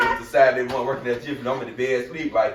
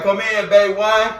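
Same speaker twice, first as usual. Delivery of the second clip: slow, higher called-out announcement; calling out; 7 semitones higher, 5 dB louder, slower than usual. Retold or imitated. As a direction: call it out slowly across the shop floor. Calling out over shop intercom.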